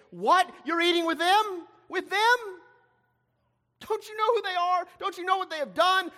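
high-pitched, incredulous protest; scandalized outrage. Acted. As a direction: fire appalled questions, voice pitched right up. Pharisees' shocked objection to Jesus.

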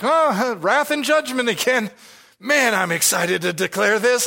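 high-pitched put-on enthusiasm; mock excitement. Preacher pretending delight at declaring wrath and judgment, mock excitement. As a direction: pretend to be thrilled about grim news. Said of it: "if we really are, are excited".

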